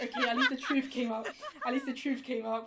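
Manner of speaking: loud, laughing through the words; amused, laughing retelling; laughing relief. Retold or imitated. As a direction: laugh as you repeat the line. Laughs at the confession.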